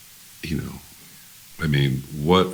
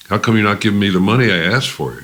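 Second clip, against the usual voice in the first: higher, quick, mock-indignant challenge; acted demand; 7 semitones higher, 4 dB louder, faster than usual. Hag confronting label boss about money, retold indignant demand. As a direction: demand an answer fast, voice pitched up.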